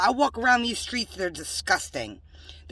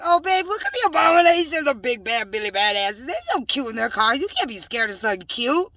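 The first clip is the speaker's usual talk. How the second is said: mocking; 4 semitones higher, 6 dB louder than usual. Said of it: "I be like".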